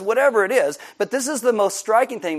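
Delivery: normal talking voice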